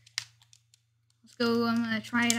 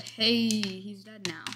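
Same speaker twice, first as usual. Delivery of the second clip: drawn-out, mildly happy self-quote; slow replay of a cheerful remark; much slower than usual. Retold or imitated. Gamer quoting own cow remark, slowly and happily.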